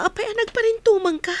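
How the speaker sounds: quick, high startled question. Acted. Joy startled as Kevin suddenly kneels, high quick question. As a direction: blurt it out, caught off guard.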